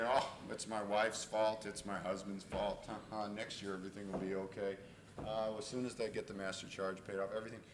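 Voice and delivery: low, soft imitation of an amateur psychologist; lightly voiced know-it-all. Little psychologist excusing problems, mildly mocking low murmur. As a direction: lightly mimic someone explaining away problems. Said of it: "little psychologist who knows things".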